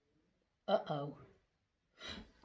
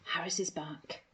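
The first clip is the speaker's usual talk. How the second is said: ordinary delivery, nothing marked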